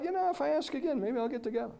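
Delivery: high, lightly voiced scheming child; hopeful kid's thought. Boy thinking about asking mom again, hopeful. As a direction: imitate a boy talking himself into hope. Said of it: "but then he thought".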